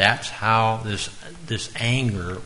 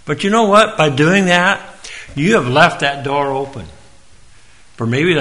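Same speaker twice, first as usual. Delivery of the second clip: preaching loud; 6 semitones higher, 8 dB louder than usual. Real, not acted. Preacher builds to full force.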